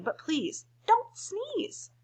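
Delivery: slow, high pleading; drawn-out plea. Acted. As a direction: stretch out the begging. Buffalo begs elephant.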